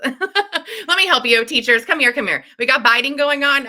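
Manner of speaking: high and loud, a bright come-here call; rally the teachers over, mock-heroic; hammed-up eager helper calling out.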